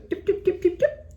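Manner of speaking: very high, squeaky dip dip dip; mocking beeps. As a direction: squeak out a string of teasing beeps. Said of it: "He goes".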